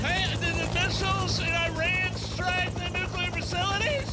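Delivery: high loud shouted question; mock reporter yelling. Pretend reporter yelling at Trump over rotors, high and loud. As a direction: yell the question over a helicopter.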